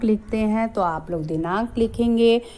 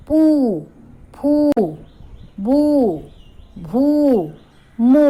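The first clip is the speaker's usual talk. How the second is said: higher and louder, each syllable called out; loud drilled syllables; 5 semitones higher, 5 dB louder, slower than usual. Shown as a demonstration. Teacher calling syllables to children, loud. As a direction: ring out चू छू जू झू. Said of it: "प पर बड़े ऊ की मात्रा".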